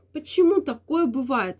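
ordinary talk, nothing marked

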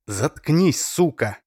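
loud vicious snap; cutting off. A narrator's reading. Oleg cuts Masha off, loud and vicious. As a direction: shut her up hard. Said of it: "оборвал её Олег".

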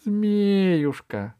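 high, stretched call. Acted. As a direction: hail someone from a distance. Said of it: "крикнула Алиса". (звонкий оклик)

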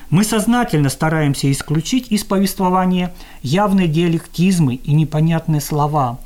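ordinary delivery

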